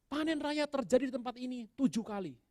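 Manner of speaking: high-pitched hyped-up worship leader impression; pemimpin pujian. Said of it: "W L-nya bilang gini". (pemimpin pujian berseru-seru dengan nada tinggi)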